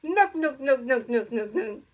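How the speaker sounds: Curly nyuk. Retold or imitated. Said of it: "I'ma do the ... like this".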